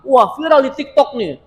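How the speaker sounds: high, slowed mock excitement; hammed-up hype of someone chasing a viral food; imitating trend-chasers. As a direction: gush like an easily swayed follower. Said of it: "orang kadang-kadang latah".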